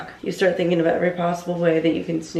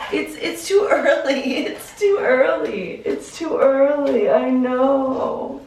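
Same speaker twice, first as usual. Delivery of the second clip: higher, slow cooing pet voice; 7 semitones higher, slower than usual. Real, not acted. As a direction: coax the dog back to bed, sing-song. Baby talk to her dog.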